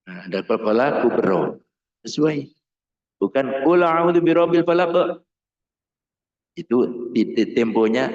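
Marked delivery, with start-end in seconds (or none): 3.7-5.3 hurried, high chant of the verse; wrongly timed recitation. Shown as a demonstration. Teacher shows students an unsuitable tempo, rushed recitation. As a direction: rush through the verse on one high tone. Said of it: "ritmenya tidak sesuai".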